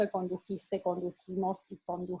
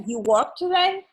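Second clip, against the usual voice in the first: "You work today" said high as a question; teacher demoing a question without "did"; question intonation; 9 semitones higher, 4 dB louder than usual. Shown as a demonstration. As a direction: rise on "today" like asking. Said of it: "It's like".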